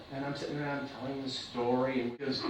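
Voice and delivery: ordinary talk, nothing marked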